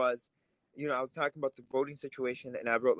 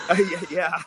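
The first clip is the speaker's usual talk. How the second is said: a real laugh, louder, spilling into the yeah; laughing yes; 4 semitones higher, 8 dB louder than usual. Real, not acted. Guest laughing at the host's maga Republican jab, laughing yeah.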